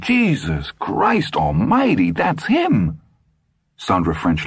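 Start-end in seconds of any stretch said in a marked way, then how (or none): 0.0-3.0 slow, high, stunned muttering of a man in shock; drawn out in horrified disbelief. A narrator's reading. Ed stunned after moaning.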